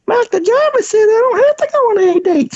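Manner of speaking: very high, strained joke voice; answering dad in a silly high voice; silly put-on voice. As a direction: squeak it out in a mock falsetto to dodge the question. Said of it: "I started talking like that".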